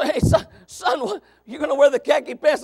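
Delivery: dad hollering at his son; calling out. Retold or imitated. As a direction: shout across the house.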